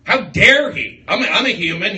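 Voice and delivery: loud, offended rant at the heckler; indignant outburst. Retold or imitated. Comic telling off a heckler, mock outrage. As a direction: act out being outraged how dare he. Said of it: "I go".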